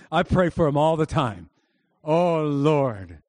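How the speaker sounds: usual speaking voice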